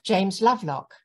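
ordinary talk

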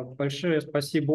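ordinary talk

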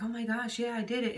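light, high mock boast; prideful. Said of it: "not like a prideful thing".

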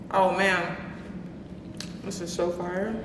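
talking between big bites; wolfing down food. Real, not acted. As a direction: mouth full of burger. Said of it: "Like inhaling this burger right now".

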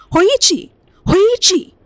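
very high, loud, desperate calls; urgent shouting. A narrator's reading. The servant yells into Hoichi's ear while shaking him, very loud. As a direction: yell right into his ear.

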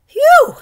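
loud, high gust of relief; child sighing with relief after a close call; relieved phew. A narrator's reading. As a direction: blow it out like you just escaped. Relief after a narrow escape.

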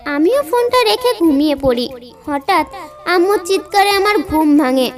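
usual speaking voice

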